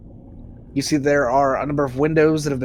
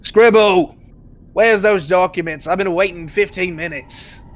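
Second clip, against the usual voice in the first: loud, higher-pitched bellow at an underling; booming voice; 4 semitones higher, 5 dB louder than usual. Acted. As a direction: shout for the assistant across the room. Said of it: "you hear a booming voice go".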